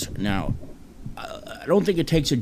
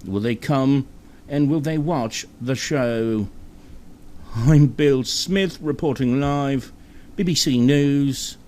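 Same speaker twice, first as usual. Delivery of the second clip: very dramatic British reporter; 5 semitones higher than usual. Acted. Host announcing the show's return as a BBC report, melodramatic British reporter. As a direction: stretch each phrase like momentous breaking news. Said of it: "Just very, very dramatic".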